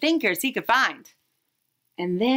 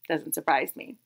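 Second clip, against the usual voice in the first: wry aside; 6 semitones lower, 6 dB quieter than usual. Acted.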